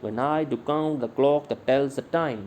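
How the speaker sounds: slow, with "I", "count", "clock", "tells" and "time" stressed; scanned iambic stress. Shown as a demonstration. Teacher scans the upper line slowly for the class. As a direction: read it beat by beat. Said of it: "इस तरह से ऊपर वाली".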